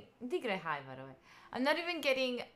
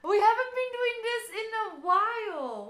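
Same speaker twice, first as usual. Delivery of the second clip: much higher and louder, ringing out; excited greeting; 11 semitones higher, 8 dB louder than usual. Real, not acted. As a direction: call it out like a big welcome back. Streamer back after a break.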